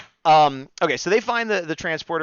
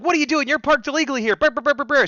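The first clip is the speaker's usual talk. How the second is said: high-pitched scolding chatter; Betty; 10 semitones higher, faster than usual. Retold or imitated. Host imitating Betty fussing over illegal parking, high mock nagging. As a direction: rattle off a mock complaint way up high, ending in babble. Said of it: "Betty's like".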